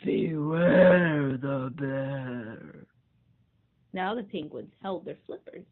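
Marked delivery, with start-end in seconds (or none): 0.1-2.9 slow loud bear growl, building; very slow booming bear warning; growly bear, closer. Acted. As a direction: growl it louder as it nears. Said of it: "The voice came closer".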